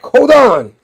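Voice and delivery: high, loud acted shout; urgent call. Harry calls out mid-fight.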